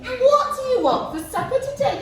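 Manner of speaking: high, loud, bouncy question; expressive. Shown as a demonstration. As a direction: ask it with lots of expression. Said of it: "Can we say it with some expression?".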